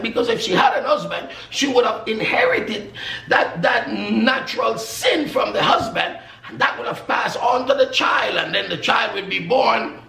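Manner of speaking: loud, high, ringing pulpit proclamation; preaching at full force. Acted. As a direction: preach it out to the whole church. Preacher proclaims Mary's conception.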